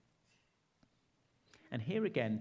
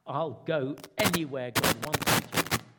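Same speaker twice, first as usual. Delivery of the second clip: declaring Helen; 8 dB louder than usual. Retold or imitated. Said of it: "That's what she said".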